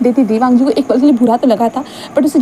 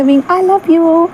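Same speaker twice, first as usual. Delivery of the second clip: drawn out love call; 6 semitones higher, much slower than usual. Acted. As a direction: stretch every word out lovingly. Wang Ji repeats love with heart gesture.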